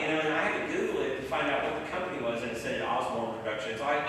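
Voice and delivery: ordinary delivery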